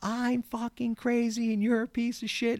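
high, slow, yelled rant at the handyman; hammed-up crazy yelling, high and drawn out; screaming rant. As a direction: scream it out like you've lost it. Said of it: "even louder than before".

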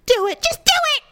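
very high, building mock scream; daring squirrel yell. Suicidal squirrel on the street, screaming to driver. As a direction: goad the driver, escalating to a squeal. Voices squirrel begging to die.

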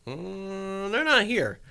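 a hammed-up, baffled aside; puzzled remark. Finds house empty again.

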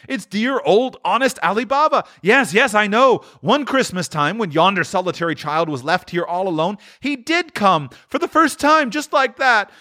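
high, excited reminiscing; ecstatic Scrooge. A narrator's reading. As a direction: pour out delighted memories in a swooping high voice. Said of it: "Scrooge exclaimed in ecstasy".

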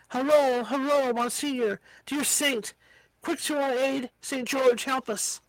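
plain reading voice

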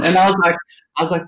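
ordinary talk

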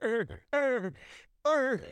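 high, mock-upset "er" noises; upset grunt. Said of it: "the first reaction was like".

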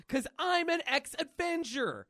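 high, slow hero announcement; proud declaration. Acted. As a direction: draw it out grandly, pitched high. Deadpool proudly explains himself to girl.

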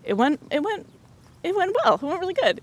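high, bright "it went well" with a falling finish on "well"; enthusiastic praise. Shown as a demonstration. Boss praising the interview, high and enthusiastic. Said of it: "it could totally be".

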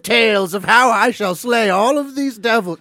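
grand, archaic and loud, like a tyrant; dark lord. Acted. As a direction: declaim it like an old-world ruler. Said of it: "just how she speaks".

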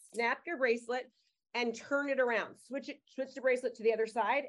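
normal talking voice

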